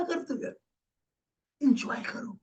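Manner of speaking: soft breathy hush on enjoy; whispered. Acted. Speaker coaxing his team to relax, whispered. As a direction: whisper it like a secret tip.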